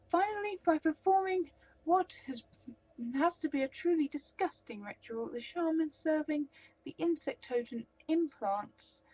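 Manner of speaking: high, loud elf voice rising with relish; the elf savours the gruesome ritual; theatrical elf, building. Acted.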